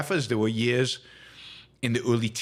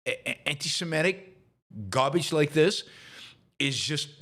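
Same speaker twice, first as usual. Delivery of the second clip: ordinary talk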